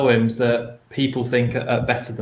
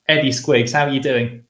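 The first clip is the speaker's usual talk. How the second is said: ordinary talk, nothing marked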